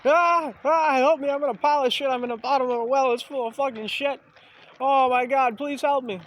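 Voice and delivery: high, loud, panicked cries for help; mock screaming for help. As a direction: yell up from the bottom of the well. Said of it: "You're gonna be screaming and be like".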